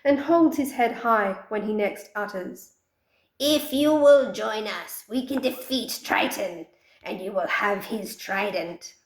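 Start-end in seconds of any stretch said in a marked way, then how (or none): 3.4-8.9 chest out, bargaining boldly; confident wizard voice. Acted. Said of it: "These words simply make the wizard more confident".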